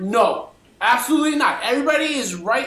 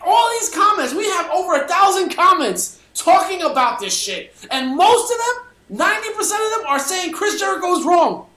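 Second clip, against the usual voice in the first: excited; 7 semitones higher than usual. Real, not acted. Thrilled about the comments.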